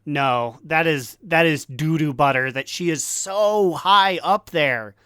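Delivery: ordinary talk, nothing marked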